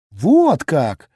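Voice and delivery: a loud gasp of mock shock. Lilya gasps at the detective, loud and surprised. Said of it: "ахнула Лиля".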